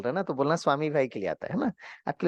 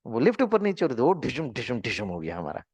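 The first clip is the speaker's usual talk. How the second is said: usual speaking voice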